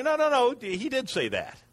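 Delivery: high, insistent, slowing down at the end; protesting woman. Acted. Woman to the serpent, high and insistent. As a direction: push back, flustered and high.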